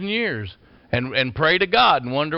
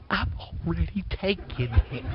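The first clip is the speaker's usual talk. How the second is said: whispered, softer aside; 7 dB quieter than usual. Retold or imitated. Answering the man on the phone, whispered. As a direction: breathe it out like a secret. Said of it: "I went".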